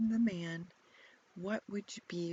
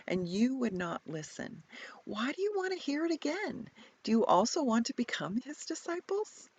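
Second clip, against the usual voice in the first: louder, higher, fed-up retort; exasperated; 8 semitones higher, 5 dB louder than usual. Acted. Healed man to questioning Pharisees, exasperated. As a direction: sigh it out like you've said it before. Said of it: "Hear the tone of his voice".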